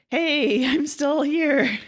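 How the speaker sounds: high, loud mock call from inside a tomb. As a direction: call out to be found, stretching the last words. Buried narrator calls from tomb.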